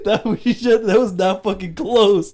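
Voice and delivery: high, loud words through laughter; laughing while talking. Real, not acted. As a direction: say it cracking up. Laughing at bad accent.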